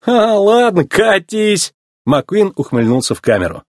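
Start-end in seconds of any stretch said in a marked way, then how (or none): 0.0-1.7 high-pitched brush-off; scornful, raised-pitch send-off; dismissive. A narrator's reading. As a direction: wave the quitter away. Boss dismissing the quitting mechanic.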